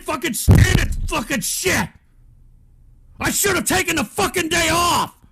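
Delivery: real rage, loud and high; furious shout. Real, not acted. Streamer who can't stand the chat, furious shouting. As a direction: explode in a strained, screaming fit of frustration.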